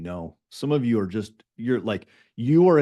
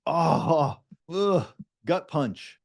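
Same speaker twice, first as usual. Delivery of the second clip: pained groan; 5 semitones higher, much slower than usual. Pretend. Imagined reaction to wasted hour.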